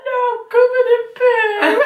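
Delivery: high, slow wail; mock sobbing refusal. Refusing a lover's kiss in shame, high tearful wail. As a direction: howl it in hammed-up tears.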